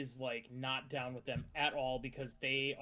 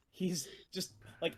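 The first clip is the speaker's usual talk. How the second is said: normal talking voice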